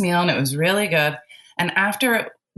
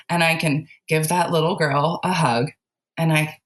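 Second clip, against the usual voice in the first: usual speaking voice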